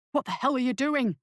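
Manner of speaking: a mildly hissed, urgent challenge; hissed reproach. A narrator's reading. Lackman to Weber leaping between pools of light, hissing. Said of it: "hissed Lackman".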